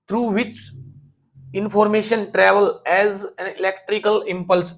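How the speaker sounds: very slow, deliberate dictation; slow question reading. Shown as a demonstration. Teacher to class, reading the next question slowly. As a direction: read each word out for students. Reads second question aloud.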